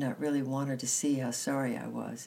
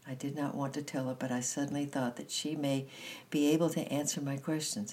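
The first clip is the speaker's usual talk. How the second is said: ordinary delivery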